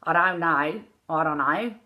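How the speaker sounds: Australian "I don't know", sliding vowels; Australian accent. Shown as a demonstration. Coach contrasting with Irish, Australian accent. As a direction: slur it with Australian diphthongs. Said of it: "like Australian".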